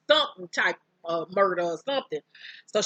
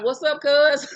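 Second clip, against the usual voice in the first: loud, higher hey-cuz greeting; cocky greeting; 6 semitones higher, 6 dB louder than usual. Retold or imitated. Boom taunting the agent outside the precinct, a loud cocky hello. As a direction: greet him like it's nothing, loud and smug.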